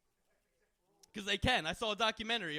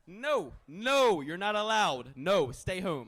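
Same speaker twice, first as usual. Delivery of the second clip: telling her off; 5 dB louder, much slower than usual. Retold or imitated. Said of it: "That's what I tell her".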